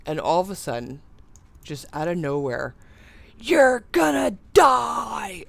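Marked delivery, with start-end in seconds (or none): 3.4-5.4 high, loud, slow retold kid yell. Schoolkids yelling at a smoker over the fence, loud high shout. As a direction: belt it like a kid hanging off a fence. Kids yell at him unexpectedly.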